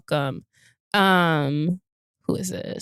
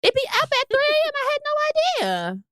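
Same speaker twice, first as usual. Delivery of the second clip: way higher, airy naive voice; mock clueless self. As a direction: play dumb about the posting time, sweetly. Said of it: "So I'm like".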